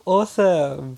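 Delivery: drawn-out delighted awesome; excited gasp. Real, not acted. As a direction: gasp then say it slowly, thrilled. Gasps at free language course.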